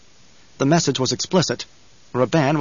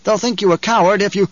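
higher-pitched, insistent goading; urgent challenge; 7 semitones higher, 3 dB louder than usual. A narrator's reading. A companion goading Muad'Dib to call out Stilgar, urgent. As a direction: press him hard to act.

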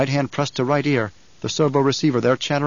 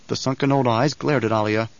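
ordinary delivery

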